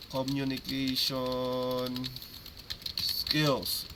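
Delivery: "communication skills" stretched out very slowly; slow, drawn-out term. Real, not acted. Coach giving players the full term, slow and drawn-out. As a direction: draw out "communication", pause, then land "skills".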